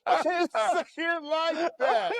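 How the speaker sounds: laughing while protesting. Real, not acted. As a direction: giggle through the words. Laughing, defending himself.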